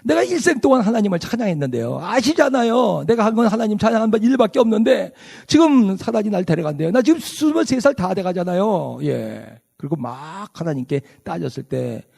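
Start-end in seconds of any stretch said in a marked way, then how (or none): 0.0-9.0 high, pleading cry to God, a bit louder; desperate crying out. Retold or imitated. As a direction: retell her desperate plea, raised and pressing. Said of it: "막 부르짖으면서 그리고 막 외쳤어요". (하나님께 부르짖는 간절하고 높은 외침)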